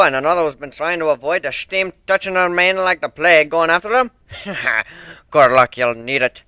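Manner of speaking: put-on loud, stern old sailor with rolled R's; stern sea dog accent. One-winged Pegasus apologising to Fluttershy, stern thick accent.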